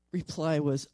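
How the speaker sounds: ordinary talk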